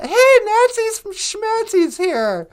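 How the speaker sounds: loud, drawn-out hey; excited announcement. Pretend. Calling out an audience member's arrival.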